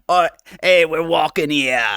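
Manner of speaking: a hint of a brash New York accent; lightly voiced New Yorker tough guy. VTuber trying out a New Yorker accent on stream, lightly done. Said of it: "a New Yorker type accent".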